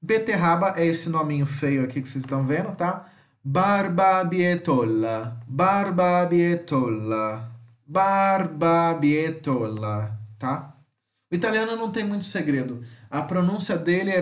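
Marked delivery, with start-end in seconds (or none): 3.4-10.2 barbabietola said slowly, bar-ba-bie-to-la, stress on bie; Italian pronunciation demo. Shown as a demonstration. Teacher repeats barbabietola slowly for his students. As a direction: stretch out barbabietola clearly. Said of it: "Prestem atenção nas minhas pronúncias".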